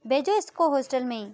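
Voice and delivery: wife shouting back at her husband, sarcastic; angry mother. Acted. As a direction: throw it back at him, bitter and loud. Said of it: "कविता की mummy बोलती है".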